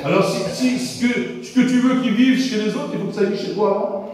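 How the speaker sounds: ordinary talk, nothing marked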